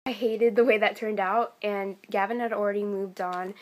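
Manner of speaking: ordinary delivery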